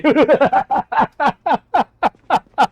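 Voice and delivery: loud high giggling fit; high burst of laughter. Real, not acted. Gamer laughing at a friend's joke, high giggling fit. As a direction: crack up at the joke.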